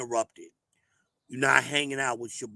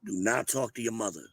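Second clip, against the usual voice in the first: normal talking voice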